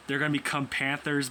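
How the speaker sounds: ordinary delivery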